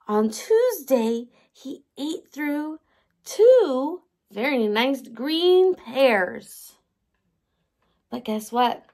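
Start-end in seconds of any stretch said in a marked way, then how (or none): 3.2-6.7 slow storytime reading. Acted.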